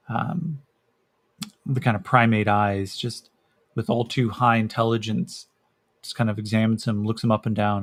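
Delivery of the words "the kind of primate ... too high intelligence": normal talking voice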